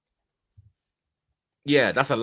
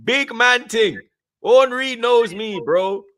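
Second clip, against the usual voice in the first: much higher and louder, bragging; hyped boasting; 12 semitones higher, 9 dB louder than usual. Real, not acted. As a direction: shout it like a big man. Hyped boasting about meeting Henry.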